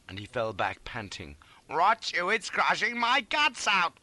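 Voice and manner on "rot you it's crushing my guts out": high, loud, pained curse; furious panicked wail; anguished cry. A narrator's reading. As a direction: curse in agony at the helper. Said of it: "I cried".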